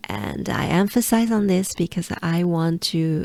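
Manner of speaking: normal talking voice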